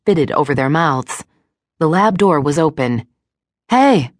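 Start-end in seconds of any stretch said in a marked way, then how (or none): 3.7-4.1 loud sharp call. A narrator's reading. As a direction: call out loud to stop them. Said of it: "she called".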